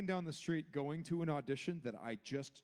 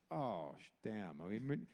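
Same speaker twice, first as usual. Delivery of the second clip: usual speaking voice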